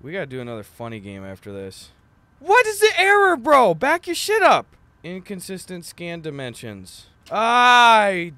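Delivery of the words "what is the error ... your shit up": loud, high exasperated outburst; frustrated yelling. Real, not acted. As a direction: yell at the broken game. Angry at game error.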